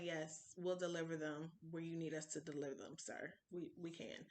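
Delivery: soft, demure re-enacted reply; hushed, obliging little voice; meek assent. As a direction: answer softly, as if starstruck. Meekly answering the star's request.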